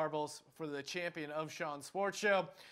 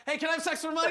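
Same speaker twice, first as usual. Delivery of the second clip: higher, louder, over-eager mock proposition; bumbling would-be john; 8 semitones higher, 5 dB louder, much faster than usual. Fumbling driver propositioning a woman from his car, mock over-eager. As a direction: blurt it out as a pretend bad attempt. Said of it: "the guy who messes up the scenario".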